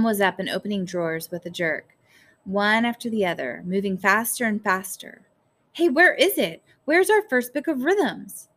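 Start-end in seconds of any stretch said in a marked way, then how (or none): 5.7-8.5 high, loud, urgent questions; frantic searching. A narrator's reading. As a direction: demand it in a rising panic. Frantic search through drawers.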